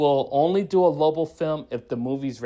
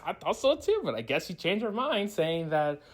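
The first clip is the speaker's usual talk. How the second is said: ordinary talk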